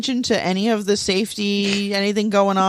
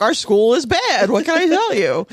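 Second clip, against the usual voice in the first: daughter's shrug; 5 semitones higher than usual. Retold or imitated. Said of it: "She's like".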